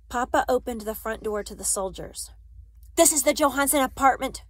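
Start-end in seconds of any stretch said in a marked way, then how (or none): 3.0-4.4 soldier's raised, higher-pitched questioning voice; loud demand. A narrator's reading. Soldier at the front door questioning Papa, loudly. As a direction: bark the question at the household. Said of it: "A deep voice asked the question loudly".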